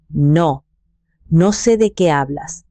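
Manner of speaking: ordinary delivery, nothing marked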